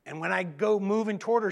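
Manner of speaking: ordinary delivery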